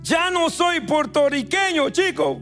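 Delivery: ordinary talk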